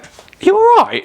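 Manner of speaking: very high, drawn-out mum voice; loud, high-pitched mock check-in; concerned mum. Mum asking son at a gig, mock concern.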